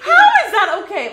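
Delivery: real shock, very loud and high, laughing; shocked laughing outburst. Real, not acted. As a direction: gasp out in outraged disbelief, slow. Shocked at the insult story.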